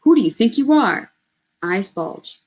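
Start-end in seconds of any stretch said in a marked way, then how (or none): 0.0-1.1 louder, higher furious snarl. Acted. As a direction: rage at the boy while choking. Attacker choking the boy.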